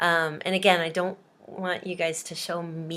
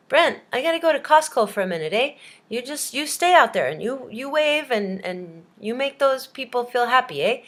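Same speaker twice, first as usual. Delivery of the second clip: higher, louder hammed-up Canadian boss; 4 semitones higher, 4 dB louder than usual. Canadian guy interviewing Brent, hammed-up Canadian boss. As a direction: play a Canadian employer, punching each "eh". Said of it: "the Canadian guy ... says".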